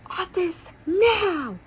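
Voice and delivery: high, loud, drawn-out yelled order; furious shout. A narrator's reading. Furious teacher sending a pupil to the office, shouting. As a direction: shout it angrily, stretching the last word. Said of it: "is furious".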